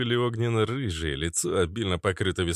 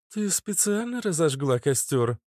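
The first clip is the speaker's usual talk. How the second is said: higher-pitched woman's voice; inquiring Ishtar; 9 semitones higher than usual. Acted. Ishtar asks the girl about fire, higher-pitched. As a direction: ask with raised pitch, curious.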